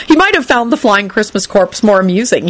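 usual speaking voice

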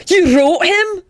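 very high-pitched incredulous outburst; mild yell of high disbelief; disbelieving yell. A narrator's reading. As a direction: burst out in disbelief, voice shooting up. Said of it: "Benji yelled in disbelief".

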